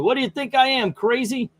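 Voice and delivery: high, loud furious outburst; Joker exploding. Acted. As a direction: blow up at the warden. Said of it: "causing Joker to kind of explode".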